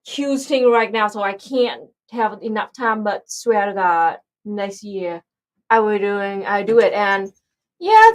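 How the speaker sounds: ordinary talk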